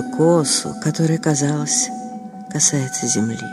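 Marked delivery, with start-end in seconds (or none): none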